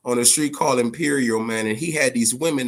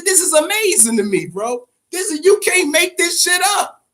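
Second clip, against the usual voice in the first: high and loud disbelief; way up in pitch, loud, can't-believe-it exclamation; amazed outburst; 4 dB louder than usual. Retold or imitated. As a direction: cry out in stunned delight. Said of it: "I said".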